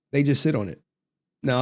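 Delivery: ordinary talk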